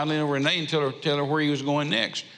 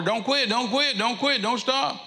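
retold holler; 9 semitones higher than usual. Churchgoers begging the preacher not to quit, retold holler. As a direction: imitate people pleading in quick repeats, pitched high. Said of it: "and they'd holler".

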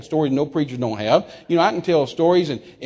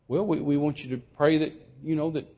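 soft, faltering explanation; meek hesitation; 7 dB quieter than usual. Retold or imitated. Cowed preacher explaining his request, meek hesitation. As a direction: feel for the words timidly.

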